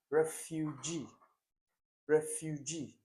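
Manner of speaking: refugee said slowly, FU held long; stress on second syllable. Shown as a demonstration. Teacher modelling a word for exam students, stressed syllable drawn out in refugee. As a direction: stretch the middle of refugee, evenly spaced. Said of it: "the stress is on what".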